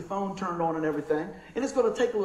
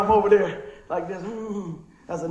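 normal talking voice

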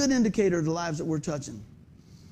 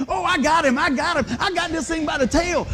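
high, eager mock boasting; overconfident crowing; 8 semitones higher, 3 dB louder than usual. Someone sure they have it beaten, overconfident crowing. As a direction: crow as if victory is certain.

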